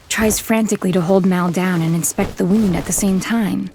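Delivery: usual speaking voice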